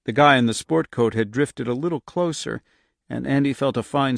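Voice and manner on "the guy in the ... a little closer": usual speaking voice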